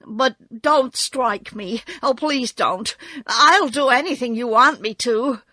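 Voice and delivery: trembling. A narrator's reading. Little man begging not to be struck, trembling. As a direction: plead with a touch of panic. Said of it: "in a trembling voice".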